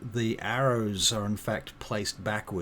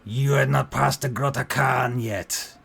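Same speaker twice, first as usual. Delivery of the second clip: loud, raised orc voice in broken speech; orc child, demanding; 4 semitones higher, 9 dB louder than usual. Acted. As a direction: demand answers like a wary little orc.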